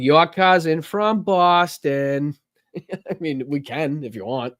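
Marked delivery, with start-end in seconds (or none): 0.0-2.4 put-on thick Boston accent, drawn out and louder. Host mocking how he could sound, thick Boston accent. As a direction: play someone's Boston cousin, broad and slow. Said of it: "you don't wanna sound like".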